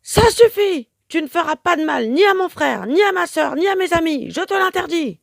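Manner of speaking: very high, loud defiant roar; the timid parrot defends his friends with a yell; heroic yell. A narrator's reading. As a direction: stand up and forbid her. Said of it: "et hurle".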